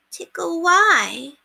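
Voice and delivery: put-on very high, slow little girl; a tiny child asking why; toddler voice.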